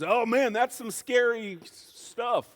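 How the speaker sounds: high, loud, slowed exclamation; drawn-out comic dismay; mock alarm. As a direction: imitate a neighbor aghast, catching himself before a swear. Neighbor's retold reaction to teen driver.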